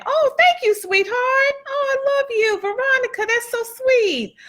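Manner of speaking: high, bubbly gratitude; gushing thanks. Real, not acted. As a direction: squeal your thanks to a dear friend. Gushing reply to a friend's thanks.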